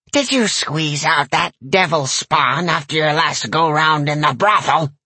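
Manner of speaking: crabby granny voice; a nasty crone's insult; crude old lady. A narrator's reading. Granny character voice.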